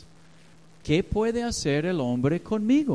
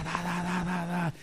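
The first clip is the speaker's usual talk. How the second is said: gunfire noises; 3 semitones higher, 5 dB quieter than usual. Retold or imitated. Imitating gunfire while telling story.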